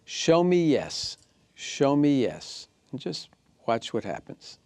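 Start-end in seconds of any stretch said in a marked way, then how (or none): 0.1-2.7 slow, drawn-out command, raised a touch; slow, deliberate teacher command. Shown as a demonstration. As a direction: ask the pendulum slowly and deliberately. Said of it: "hold it still and say".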